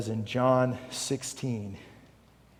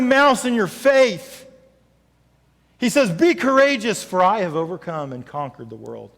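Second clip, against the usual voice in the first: louder, higher, slowing on fights for you; rousing preaching; 8 semitones higher, 10 dB louder than usual. Acted. Pastor telling each congregant God fights for them, rousing. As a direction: build conviction name by name.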